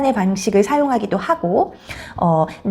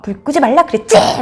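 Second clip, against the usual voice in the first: higher-pitched acted outburst; moderately raised scolding shout; dad's angry yell; 4 semitones higher, 4 dB louder than usual. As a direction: blow up as the fed-up dad. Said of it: "소리를 빽 하고 지르고".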